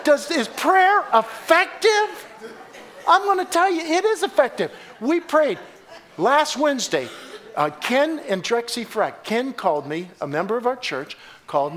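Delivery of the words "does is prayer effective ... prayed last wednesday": high, emphatic preacher's proclamation; full-force preaching. Real, not acted. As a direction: drive the conviction home to the congregation.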